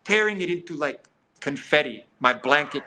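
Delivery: ordinary delivery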